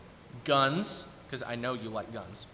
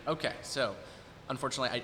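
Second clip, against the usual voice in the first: ordinary talk, nothing marked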